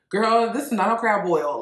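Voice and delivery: loud, sassy, unimpressed; sassy dismissal. Retold or imitated. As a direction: shut it down with attitude. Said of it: "y'all was like".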